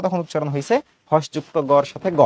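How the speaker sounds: usual speaking voice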